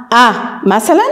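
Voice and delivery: normal talking voice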